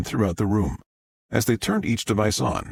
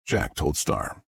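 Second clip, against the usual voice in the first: ordinary delivery, nothing marked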